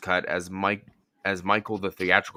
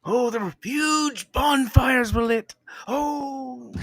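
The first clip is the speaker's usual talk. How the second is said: high, slow, over-the-top cry; dramatic shout; 13 semitones higher, much slower than usual. Pretend. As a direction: ham it up like a melodrama. Hammed-up dramatic movie line.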